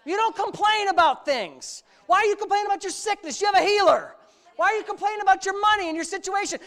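loud, high, hammering sermon shout; fiery preacher driving the point home; full-force preaching. Acted. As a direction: preach it hard at the congregation, some lines slowed.

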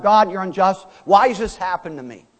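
mock accusation. Mock complaint to God.

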